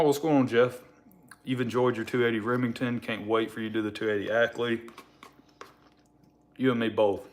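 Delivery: ordinary talk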